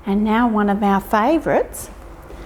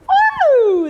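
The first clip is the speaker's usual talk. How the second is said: a high, loud whoop; a gleeful whoop as the mouse bounces; excited woo; 5 dB louder than usual. Acted.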